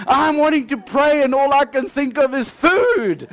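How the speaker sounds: can't stop laughing while telling it. Real, not acted. Preacher laughing at his own fast story.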